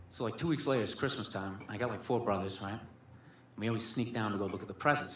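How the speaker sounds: ordinary talk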